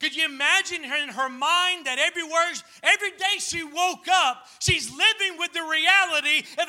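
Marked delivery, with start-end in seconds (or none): none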